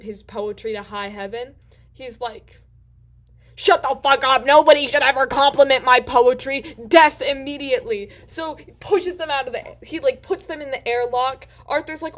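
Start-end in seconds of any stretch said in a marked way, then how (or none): 3.5-8.1 mock Vogon rage yell; furious shout. Vogon captain shouting down Arthur and Ford, furious. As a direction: bellow it, much louder, in a put-on alien voice.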